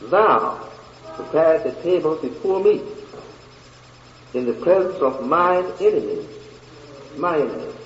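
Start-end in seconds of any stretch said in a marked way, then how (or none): 0.0-6.3 deliberate, lifted voice with long pauses; slower scripture reading. Shown as a demonstration. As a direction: set "Thou" apart and proceed slowly.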